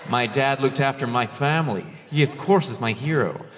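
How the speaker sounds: low, earnest put-on American accent. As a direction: play a sincere American, voice dropping low. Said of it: "an American will be like".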